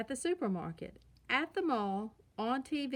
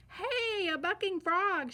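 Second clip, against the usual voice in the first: put-on much higher, thrilled cry of a boy cub; excited shout; 9 semitones higher, 4 dB louder, slower than usual. A narrator's reading. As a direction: call out in delight at the frog ride. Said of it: "shouted Brother".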